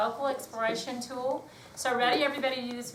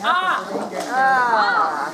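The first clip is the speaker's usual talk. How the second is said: startled cry; 3 semitones higher, 9 dB louder than usual. Real, not acted. Startled in the dark.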